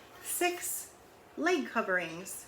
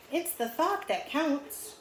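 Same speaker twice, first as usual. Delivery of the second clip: slow sage lemur; 7 semitones higher, slower than usual. A narrator's reading. Lemur's wise reply, slow.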